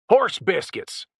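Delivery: high, loud furious yell; screamed. A narrator's reading. Randy screaming at Jessica in a high furious yell. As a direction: explode at her in a rage. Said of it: "Randy screamed".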